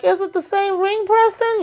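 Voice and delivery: high, accusing imitation rising on Preston; high needling question; mock pointed question. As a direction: press him in a high put-on voice.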